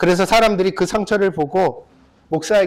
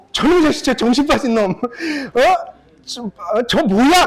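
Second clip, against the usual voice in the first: acted cursing; 4 semitones higher than usual. Zhuangzi cursing the boat still heading at him, mock anger. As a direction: curse the oncoming boat, half in fun.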